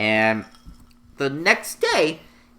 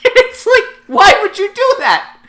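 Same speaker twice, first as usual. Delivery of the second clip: much higher, louder, laughing squeal; squeaky exasperation; 9 dB louder than usual. Retold or imitated. Reacting to the bullies, high laughing disbelief.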